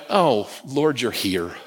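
awed hush. Retold or imitated. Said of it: "And I went".

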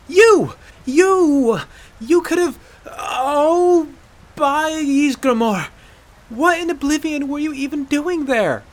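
high-pitched, exasperated outburst; finally cracking. Acted. Aela losing patience with the reckless hunter, yelling. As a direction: yell it, sputtering as composure breaks. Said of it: "she finally cracked".

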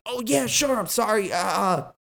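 high-pitched imitation of a flustered apology; mock apology. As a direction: put on a high, hurried-to-agree sorry voice. Retelling the salesman's flustered apology.

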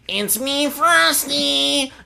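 mild high voice; lightly voiced grown woman; adult woman voice for kid. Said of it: "Magnitude is doing".

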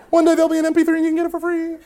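high, fast squeaky babble; mock panic. Flustered young lecturer offering a tape, comic panic.

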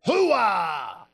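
big, drawn-out shouted catchphrase; Al Pacino. Acted. Radio host showing off his impression, shouted movie-star bellow. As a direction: holler it like the movie star, high and long. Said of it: "I can do an Al Pacino impression".

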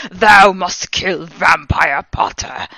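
loud, slow, grandiose villain voice; yelled villain command. A narrator's reading. Voldemort ordering the heroine to kill, loud grandiose yell. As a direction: proclaim it slowly like an archvillain. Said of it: "he yelled".

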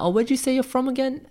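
mock high-pitched voice asking where he's from; jokey high voice of a team member who forgot; mock forgetful question. Mimicking Floyd's team asking.

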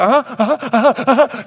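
high, loud, rapid mm-hms; hammed-up impatient anticipation; eager listening. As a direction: egg the messenger on excitedly. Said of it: "like John the Baptist".

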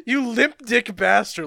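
spiteful villain insult; mock angry snarl. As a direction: hiss it out nastily. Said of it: "she starts like".

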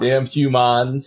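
"humans" slowly stretched to "hyoo-mons"; character-voice pronunciation. Acted. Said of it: "calling you guys humans and stuff".

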